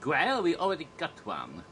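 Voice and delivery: smug mock French guard. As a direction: gloat like a French guard on the wall. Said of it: "kniggets outside, taunting you".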